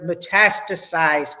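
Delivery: metastasize drawn out slowly, each syllable clear; slow term. Shown as a demonstration. Teacher repeating the new word metastasize, said slow and clear. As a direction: spell the sound of metastasize out for the class, slowly. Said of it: "Did y'all hear that word?".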